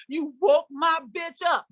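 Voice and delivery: usual speaking voice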